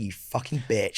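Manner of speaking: low, slow mutter; soft, sour aside. Retold or imitated. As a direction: curse under your breath, slowly. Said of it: "And I was like".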